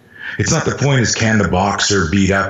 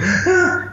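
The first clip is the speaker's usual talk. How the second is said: high mock sob-gasp; acted child's gasp; imitated hyperventilating gasp. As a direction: catch the breath like an upset kid. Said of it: "like that hyperventilating eight-year-old".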